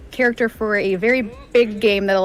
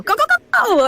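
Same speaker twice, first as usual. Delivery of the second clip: very high, energetic go go go; hyper Max voice; 4 dB louder than usual. Retold or imitated. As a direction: shout it like a hyperactive character. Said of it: "So I'd be like".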